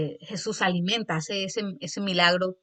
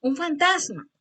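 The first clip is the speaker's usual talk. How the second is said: frightened shout; 8 semitones higher than usual. Retold or imitated. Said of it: "Lo estoy haciendo de esta manera para que usted capte".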